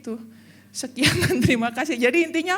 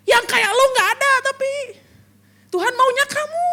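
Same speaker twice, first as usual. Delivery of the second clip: much higher, loud calling; God calling; 11 semitones higher, 8 dB louder than usual. Acted. God telling each member he wants them, high loud call. God's voice to listener.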